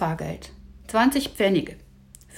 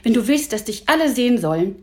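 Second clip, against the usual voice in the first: higher, louder irritated retort; mildly agitated boy's comeback; worked up; 4 semitones higher, 6 dB louder than usual. A narrator's reading. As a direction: snap back, a touch heated. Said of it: "Emil regte sich auf".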